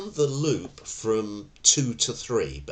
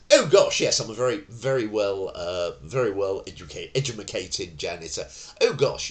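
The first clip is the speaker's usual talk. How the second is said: very well educated janitor; 3 semitones higher, 4 dB louder than usual. Pretend. Janitor cheerfully agrees to fix the tenant's door, hammed-up refined eagerness. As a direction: play the eager posh janitor, all politeness. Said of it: "for the purposes of this story".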